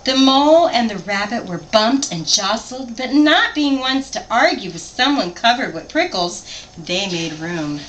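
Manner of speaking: lively picture-book read-aloud; story narration. A narrator's reading. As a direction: read it to a child, animated.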